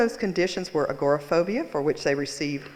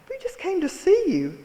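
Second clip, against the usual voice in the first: Minnie Mouse voice; 13 semitones higher, 4 dB louder than usual. Retold or imitated. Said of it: "in her Minnie Mouse voice".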